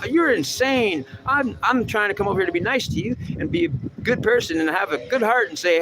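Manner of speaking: high, whiny protest; squealing hurt pal ranting; offended greeter. Acted. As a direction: complain in a high wounded voice. Acted quarrel, hurt greeter.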